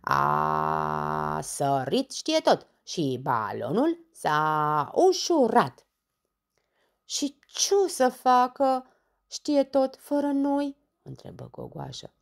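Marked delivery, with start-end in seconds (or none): 0.0-5.9 loud, slow, drawn-out explanation; exasperated outburst. Acted. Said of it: "izbucni Dondănel". 7.1-10.8 high, loud plaintive question; worried whining. Acted. Worried character asks, drawn out.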